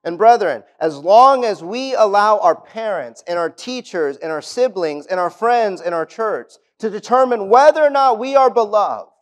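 loud, high, ringing sermon delivery; a pastor booming God's promise from the pulpit; full-force preaching. Acted. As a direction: preach it out to the whole congregation with fire.

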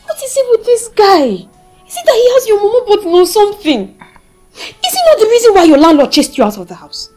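very loud indignant questioning; exasperated scolding. Acted. Friend scolding a woman about her boyfriend, exasperated. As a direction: berate your friend at high volume.

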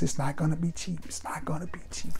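a light Denzel Washington impression, sliding into a hushed whisper. Vlogger warning viewers about the price, a hint of Denzel. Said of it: "in my Denzel Washington voice".

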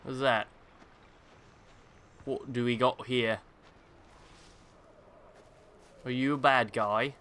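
lightly put-on British accent; British accent, half-hearted and questioning. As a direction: slip playfully into a mock British accent. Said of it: "went into a British accent".

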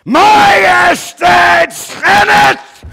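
very slow, high, loud cry of scandal; outraged shout. Acted. Actor voicing the accuser crying scandal, shouting. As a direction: cry out the sacrilege to the court.

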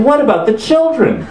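high-pitched imitated outrage; hammed-up worked-up host; mock anguished plea. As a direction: plead with exaggerated horror. Mimicked host's emotional outburst.

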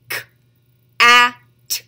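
"K, a, t" as separate sounds with pauses; sounded out. Shown as a demonstration. Teacher leading children to tap along, sounded out. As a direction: say "K, a, t" sound by sound, loud vowel. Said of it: "Do that with me".

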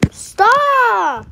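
yelled stop. Real, not acted. Yelling at teammate in game.

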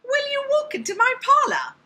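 very high, loud character voice; high-pitched voice. Shown as a demonstration. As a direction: shoot way up high, squeaky and loud. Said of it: "high-pitched voice or a deep voice".

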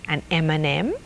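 soft, hopeful little question; hopeful asking, voice kept low. A narrator's reading. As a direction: ask it quietly, hoping for a treat. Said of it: "asked Audrey hopefully".

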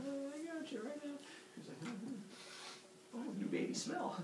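sneaky high murmur. Pretend. Kronos sneaky aside.

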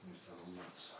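whispering. Real, not acted. Muttering to himself while prepping.

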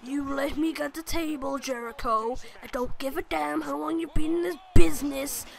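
loud, scolding wrestler rant; angry Triple H. Retold or imitated. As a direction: bark it down at the younger wrestler. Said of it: "He's just like".

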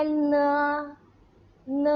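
เน as a drawn-out "ne" with a schwa; demonstrating a pinyin sound. Shown as a demonstration. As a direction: model the letter N, then hold เน long and level. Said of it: "ออกเสียงยังไงบ้าง".

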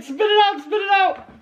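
high, urgent repeated command; urgent high call. Real, not acted. Urging spit out.

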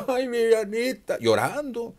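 very high, laughing mock pity; mocking cooing. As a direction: coo at her like a silly fan. Mocking a tearful fan. (burla aguda y cariñosa fingida)

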